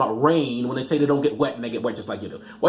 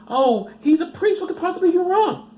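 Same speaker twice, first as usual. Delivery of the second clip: naive parents. Retold or imitated. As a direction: play the trusting parent in falsetto. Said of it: "some people are like".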